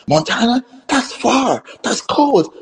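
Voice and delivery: high-pitched mock dismay; incredulous protest. Actress reacting to a faraway film location, high incredulous protest.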